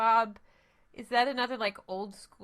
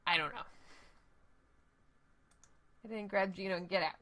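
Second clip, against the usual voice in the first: ordinary delivery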